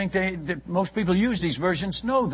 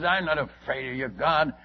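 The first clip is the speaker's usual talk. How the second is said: ordinary talk, nothing marked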